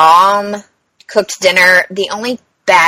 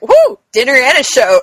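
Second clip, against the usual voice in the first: higher, louder gleeful quip; amused whoop; 5 semitones higher, 5 dB louder than usual. Retold or imitated. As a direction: crow it like a delighted spectator. Joke to the attendant after commotion.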